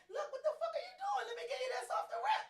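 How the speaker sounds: high, loud exasperated shout; mock yelling at a sulking man. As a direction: yell it, fed up but laughing. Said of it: "So I can say".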